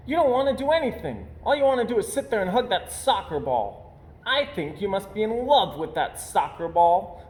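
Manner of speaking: high, fast, exasperated cub voice; irritated and impatient. Acted. Sister scolds Brother for hugging his ball, high and impatient. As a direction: nag your lazy brother impatiently. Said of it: "irritated and impatient with her brother".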